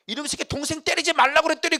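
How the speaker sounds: high, loud mother scolding; scolding mom. Retold or imitated. Mother scolding the older brother for hitting. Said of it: "누구 야단치는 거예요".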